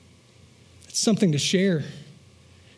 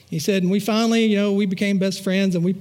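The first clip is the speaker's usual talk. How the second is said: ordinary delivery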